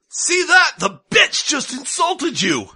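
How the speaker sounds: high shouting sandwich voice; a squeaky bully egging him on; angry meat voice. Acted. Meat yells.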